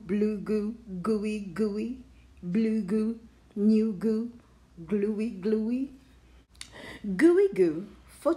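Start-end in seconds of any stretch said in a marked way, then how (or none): none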